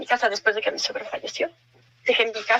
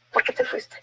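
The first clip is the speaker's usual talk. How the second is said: breathy whisper of grief; hushed plea to the dead husband; whispered reproach. Retold or imitated. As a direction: ask it under your breath, hurt.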